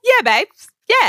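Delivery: put-on high, loud Australian-accented "Yeah"; Aussie accent. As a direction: copy an exaggerated Aussie "Yeah". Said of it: "Aussie way".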